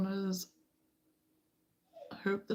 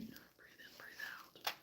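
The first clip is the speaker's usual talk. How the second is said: barely audible unvoiced whisper; faint breathing whisper; 24 dB quieter, slower than usual. Shown as a demonstration. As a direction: breathe the words out almost silently.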